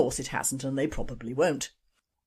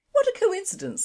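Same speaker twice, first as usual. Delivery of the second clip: high squeaky delivery. Acted. Farmer's repeated punchline.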